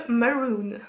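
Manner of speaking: drawn-out English-style "Maroon" from a French speaker; French speaker's attempt at English accent; self-mocked English accent. Real, not acted. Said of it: "Super l'accent".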